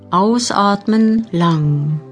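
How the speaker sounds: usual speaking voice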